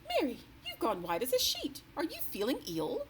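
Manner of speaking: high, concerned royal voice; puzzled concern for a pale servant; the queen's voice. A narrator's reading. The queen asking the pale maid what is wrong, concerned.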